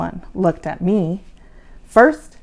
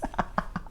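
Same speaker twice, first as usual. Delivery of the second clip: soft laugh; 14 dB quieter than usual. A narrator's reading. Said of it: "I laughed".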